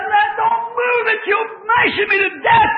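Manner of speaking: high, loud frantic begging; the pinned boy yells to stop them lifting, terrified; terrified plea. Retold or imitated. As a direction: scream to stop them moving it.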